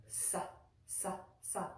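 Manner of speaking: short separate "sa" syllables with a clean s; plain s, not sh. Shown as a demonstration. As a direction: demonstrate "sa" crisply, no hush in the s. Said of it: "so it's pronounced differently".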